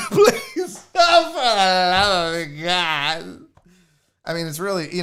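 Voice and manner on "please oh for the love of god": mock pleading. Host begging viewers for thumbs up, mock exasperated. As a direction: beg in hammed-up despair.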